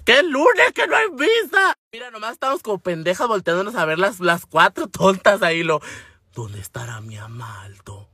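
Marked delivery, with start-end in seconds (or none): 0.0-1.7 chillido exasperado, agudo y arrastrado; exasperación actuada. Retold or imitated. Estalla ante la misma pregunta. 6.3-8.1 susurro lento y grave; susurro actuado. Retold or imitated. Las hermanas se preguntan, susurrado.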